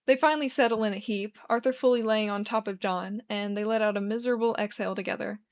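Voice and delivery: plain reading voice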